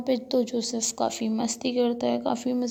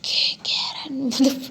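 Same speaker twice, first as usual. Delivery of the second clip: put-on whispered girly call; 2 semitones higher, 4 dB louder, much slower than usual. Shown as a demonstration. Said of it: "बाकी लड़कियों जैसे ऐसे नहीं बोल सकती ना".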